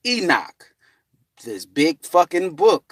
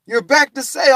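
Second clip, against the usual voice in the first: eager greeting; 13 semitones higher, 6 dB louder than usual. Retold or imitated. Others repeat the greeting.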